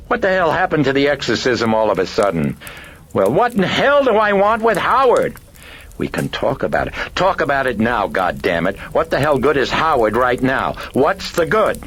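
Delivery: high, loud hysterical outburst; hysterical cry. A narrator's reading. Chris cries out at the priest in sudden hysteria, high and loud. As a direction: scream it at him, losing control.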